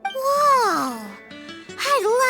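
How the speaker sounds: high, drawn-out gasp of wonder; amazed. Acted. Amazed by two-tail news.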